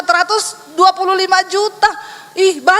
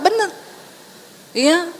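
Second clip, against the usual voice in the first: ordinary talk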